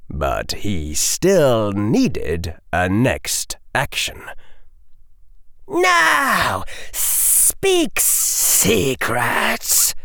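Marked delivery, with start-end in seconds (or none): 5.7-10.0 high, slow, hissing villain; hissing dark lord. Acted. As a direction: hiss the command slowly. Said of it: "the Dark Lord hissed".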